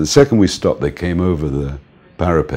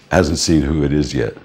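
ordinary delivery

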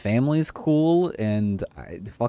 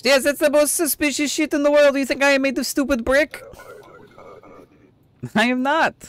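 mock outraged voice; 13 semitones higher, 3 dB louder than usual. Mocking a bribe attempt.

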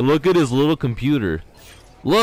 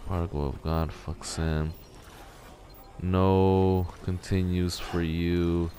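quieter mock villain taunt; 7 semitones lower, 8 dB quieter, slower than usual. Rereads villain's message.

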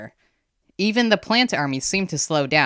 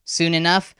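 ordinary delivery

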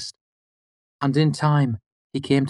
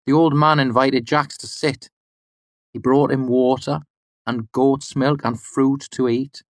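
usual speaking voice

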